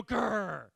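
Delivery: loud demo growl at a neighbour; mock tiger growl. Shown as a demonstration. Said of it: "turn to somebody and go".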